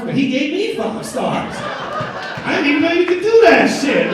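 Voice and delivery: high, loud, drawn-out disbelief; amazed. Acted. Comic learns the driver rated him too, loud amazement. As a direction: climb to an astonished shout on the punchline. Punchline, amazed at five stars back.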